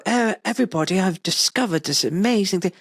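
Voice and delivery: high, mock-posh announcement; very posh gentleman. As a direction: play a well-spoken posh gent. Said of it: "a very smart suit and a very posh voice".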